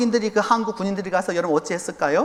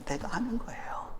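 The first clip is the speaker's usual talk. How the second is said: breathy whisper; 14 dB quieter than usual. Real, not acted. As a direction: whisper it intimately. Whispered answer to own question.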